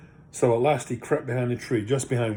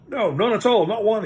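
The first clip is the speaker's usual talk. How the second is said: high, hasty hobbit backtrack; 9 semitones higher, 5 dB louder than usual. Acted. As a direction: take it back in a hurry. Bilbo corrects himself.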